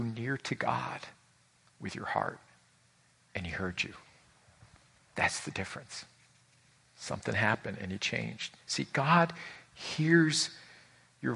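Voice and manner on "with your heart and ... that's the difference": soft intimate whisper; hushed preacher's whisper. Real, not acted. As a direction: lean in and whisper the point. Preacher drops to intimate point.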